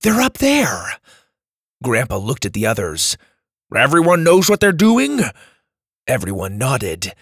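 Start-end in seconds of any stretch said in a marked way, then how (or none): 0.0-1.0 excited shout. Acted. Character exclaims in battle. 3.7-5.1 rallying call. Acted. Grandpa rallies the group.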